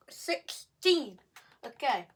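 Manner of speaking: ordinary talk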